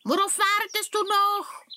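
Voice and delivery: very high, loud squeaky witch; high shrill-pitched hag brushing him off. Acted. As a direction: cackle it out like an impatient little witch. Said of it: "antwortet die Hexe".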